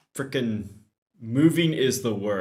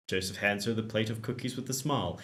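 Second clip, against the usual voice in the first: ordinary talk, nothing marked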